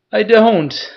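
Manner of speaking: high, loud blubbering cry. Acted. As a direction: wail it out, beaten and pleading. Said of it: "He was blubbering".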